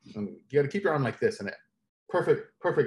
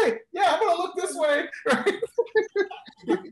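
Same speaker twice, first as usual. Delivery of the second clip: much higher, laughing, a silly put-on voice; mock eager trainee; slower than usual. Jumper mimicking a trainee's reply: high, giggly put-on voice. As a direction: play the wide-eyed trainee who gets it. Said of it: "You're like".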